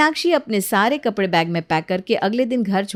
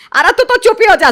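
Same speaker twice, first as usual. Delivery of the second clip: much higher and louder, shut her up; furious shout; 10 semitones higher, 10 dB louder than usual. Acted. Mother silences the new bride. (ऊँची आवाज़ में चिल्लाकर चुप कराना)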